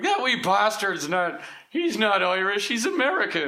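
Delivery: hammed-up Irish grumbling that climbs into a mock wail; mock Irish accent. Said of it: "that's how Irish people talk".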